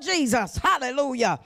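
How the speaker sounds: normal talking voice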